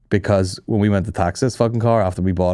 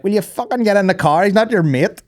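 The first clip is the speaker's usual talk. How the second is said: put-on high, nagging older woman; scolding; 10 semitones higher than usual. Older partner calling cop back to car, high scolding voice. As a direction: scold like an exasperated ma.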